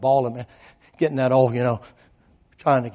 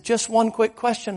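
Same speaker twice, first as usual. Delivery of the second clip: high, louder insistence; urgent retold appeal; 10 semitones higher, 5 dB louder than usual. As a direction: press for one more moment. Preacher urgently stops the fleeing girl.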